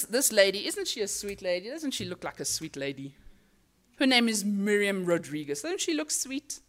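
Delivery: ordinary delivery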